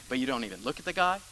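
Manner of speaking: ordinary talk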